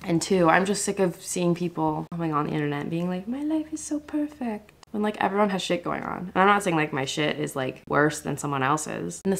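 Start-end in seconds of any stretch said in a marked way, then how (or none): 3.3-4.7 mock whisper. Mocking perfect influencers.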